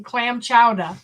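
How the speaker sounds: clam chowder in broad Boston, chowder ending in "-ah"; Boston accent. Shown as a demonstration. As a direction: drop the R like a Bostonian. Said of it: "say this with a Boston accent".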